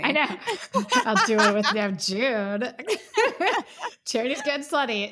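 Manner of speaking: teasing through laughter; laughing while teasing her co-host about her fantasies. Real, not acted. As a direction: giggle the words out.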